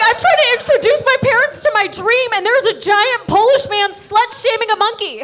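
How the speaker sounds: high, loud comic outrage; exasperated rant. Retold or imitated. Teen introducing parents to her dream, exasperated outburst. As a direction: rant in high-pitched mock despair.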